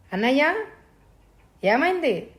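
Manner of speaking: understated shout of concern; calling from outside. A narrator's reading. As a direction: call out to your brother through a door. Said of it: "బయట నుండే అరిచాడు".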